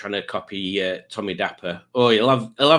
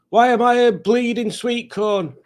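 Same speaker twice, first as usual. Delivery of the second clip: much higher toy voice, indignant; Pingu puppet voice; 11 semitones higher, 3 dB louder than usual. Pretend. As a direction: squeak it out like a baffled little toy. Voicing Pingu toy's reply.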